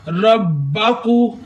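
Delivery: रब्बाकू recited very slowly, the double b pressed firmly; slow tajweed recitation of रब्बाकू; pressed shadda. Shown as a demonstration. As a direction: lean into the bb of रब्बाकू, with a crisp thin k. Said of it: "रब्बा थोड़ा सा दबाकर पढ़े".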